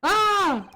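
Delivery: high loud squeal; high happy shriek; delighted shriek. Real, not acted. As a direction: squeal with glee. Reacting to kiss scene.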